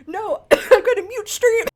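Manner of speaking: loud, high, choked cry; panicked shout. Real, not acted. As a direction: cry out mid-cough. Coughing fit, panic.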